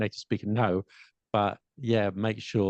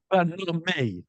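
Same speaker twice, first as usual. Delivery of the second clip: laughing; 8 semitones higher, 4 dB louder, slower than usual. Real, not acted. Joking about native speakers.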